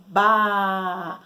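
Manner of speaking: "ba" with the vowel A held long; sustained "baaa" for teaching; drawn-out syllable. Shown as a demonstration. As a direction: stretch "ba" so the A rings on. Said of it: "olha só o som".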